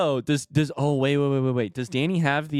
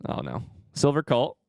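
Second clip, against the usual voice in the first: ordinary talk, nothing marked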